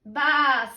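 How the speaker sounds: loud baa; sheep's bleat. A narrator's reading. Sheep answering the ladybird, loud baa. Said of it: "said the sheep".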